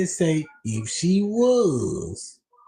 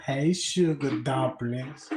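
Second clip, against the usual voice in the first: mock seductive caller; 6 semitones lower, slower than usual. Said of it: "call you early in the morning talking about".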